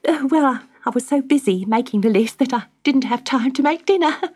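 high, eager wife's voice bubbling with news; a thrilled wife who can't wait to tell; excited wife. Acted. Wife Magda telling husband about the fairy letter, high and thrilled. Magda reads out her wish list.

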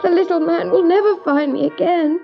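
high, understated despairing sob; sobbed. A narrator's reading. Eloise fears the little man is gone, mildly sobbing. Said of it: "sobbed Eloise".

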